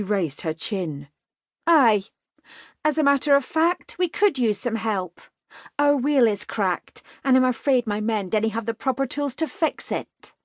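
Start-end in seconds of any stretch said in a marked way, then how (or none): 1.7-7.0 higher, haughty, measured reply; dignified. A narrator's reading. Katie answers the riders with dignity, higher haughty tone. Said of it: "Drawing on her dignity". 7.2-10.3 higher, earnest formal explanation of "the proper tools"; dignified. A narrator's reading. Katie explains the broken wheel, earnest higher voice. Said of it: "Drawing on her dignity".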